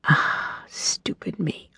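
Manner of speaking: a hushed, slow aside scolding herself; whispered self-reproach. Real, not acted. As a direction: whisper it, slow and soft, to yourself.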